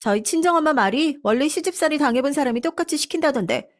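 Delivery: high, needling retort; snide daughter-in-law. Acted. Daughter-in-law quoting her own mother at mother-in-law, high insolent jab.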